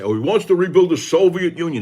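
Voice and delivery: high, loud sneering parody of a pundit; mock pundit outrage; mocking the warmongers. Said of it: "the warmongers out there like Levin and Hannity say".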